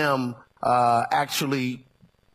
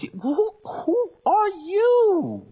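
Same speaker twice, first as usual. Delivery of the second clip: slow, wailing cry, much higher and louder; rebuke; 4 dB louder, much slower than usual. Real, not acted. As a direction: cry it out like a lament. Preacher challenges the doubter.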